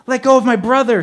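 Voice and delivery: very high, loud scream. A narrator's reading. Leslie screaming at the man choking her brother, scream. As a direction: shriek at the attacker. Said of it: "screamed Leslie".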